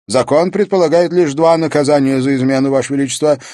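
high, projected legal pronouncement; formal court official. Acted. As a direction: prompt the king with official firmness. Chamberlain prompts king on the law.

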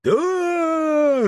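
high, drawn-out cheer; joyful children's shout. A narrator's reading. Children cheering the lunch invitation, high drawn-out shout. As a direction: cry out high like a delighted class. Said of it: "радостно закричали дети".

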